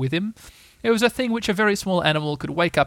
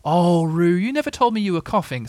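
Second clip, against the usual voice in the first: gently scolding mother, pitched up; motherly reproach, higher voice; Kanga, reproachful; 4 semitones higher, 4 dB louder than usual. Acted. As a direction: draw out the disappointed 'oh', then chide. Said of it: "said Kanga reproachfully".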